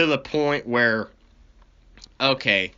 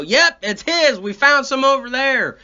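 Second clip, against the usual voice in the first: cop triumph; 11 semitones higher, 5 dB louder than usual. Retold or imitated. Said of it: "and they go".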